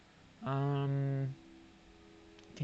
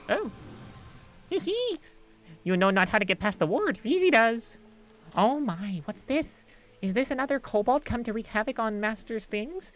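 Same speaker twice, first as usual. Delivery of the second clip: high, quick, fawning sprite voice; a giddy small servant creature gushing about Master's things; eager little creature; 6 semitones higher, 4 dB louder than usual. Acted. Reezy the creature greets Hestia, high and eager.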